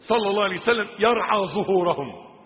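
loud, brisk preacher's delivery. Real, not acted. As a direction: announce the phrase firmly. Same words, loud then quiet. (إلقاء سريع مرتفع)

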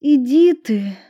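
put-on high, loud bashful girl; embarrassed. A narrator's reading. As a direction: brush it off shyly. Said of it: "засмущалась Эдисон". (смущённая девушка отмахивается)

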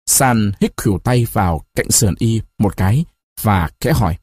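plain reading voice

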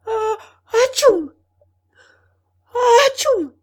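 high, explosive stage sneeze; big theatrical atchoo; acted sneezing. A narrator's reading. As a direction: build up and burst into a loud sneeze. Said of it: "Et il éternua encore deux fois".

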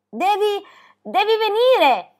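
high, loud excited call; shouting from afar. A narrator's reading. Girl calling her friend to see the flowers, excited shout. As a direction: call out urgently across a meadow, stretching it slowly. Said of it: "le gridò già di lontano".

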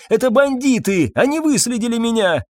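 high, frightened voice; frightened, high-pitched husband; panicked exclamation. A narrator's reading. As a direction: blurt it in alarm. Husband panics about bandits.

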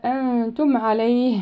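أنتم with the n hidden into a nasal before the t, slowly; أنتم with ikhfa nasalization. Shown as a demonstration. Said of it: "إخفاء النون الساكنة عند حرف التاء".

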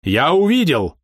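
high, loud exclamation; almost shouting. A narrator's reading. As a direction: cry it out in triumph, just short of a shout. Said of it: "почти прокричал он". (почти крича)

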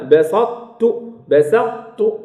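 بسطت slowly, heavy ṭ held unreleased into a light t; incomplete merging. Shown as a demonstration. Teacher models بسطت for students, partial merging keeping the heavy ṭ. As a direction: hold the ط of بسطت without bouncing, then release tu. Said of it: "ناقص".

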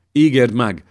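desperate mother cries out to the king; cried out. A narrator's reading. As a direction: call it out across the hall. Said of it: "kiáltotta Erzse".

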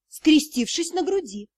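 plain reading voice

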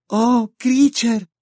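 high, slow, crying cry of pity; tearful lament. A narrator's reading. Hermione weeps over the elf's story, lamenting. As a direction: ache for the elf through tears. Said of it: "se lamentó Hermione, que también lloraba".

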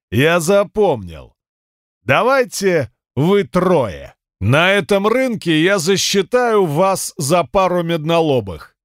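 slow, sneering brute voice; mocking cyclops. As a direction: taunt them slowly, full of swagger. Cyclops Abant taunts.

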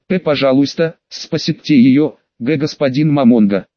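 plain reading voice

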